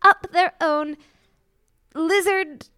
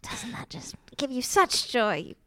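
hissed whispered insult; whispering; 3 semitones lower than usual. Acted. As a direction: whisper it with contempt. Insults officer under breath.

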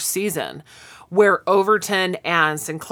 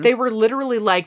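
ordinary talk

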